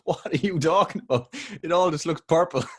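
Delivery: student reacting to a pathology lecturer, high and exasperated; baffled student. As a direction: whine it like a lost student at the slide.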